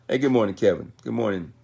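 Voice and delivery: normal talking voice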